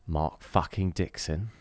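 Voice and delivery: slow, hushed mock awe; mock reverent. Host honoring a patron name, slow hushed mock awe.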